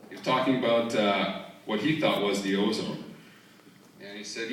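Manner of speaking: usual speaking voice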